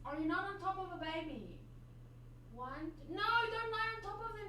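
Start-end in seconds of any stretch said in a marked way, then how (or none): 0.0-1.6 soft, higher coo to a pet; cooing to the cat. Real, not acted. Owner gently asking the cat. 3.1-4.5 high anxious plea, much higher; pleading with the cat. Real, not acted. Owner begging the cat off kittens.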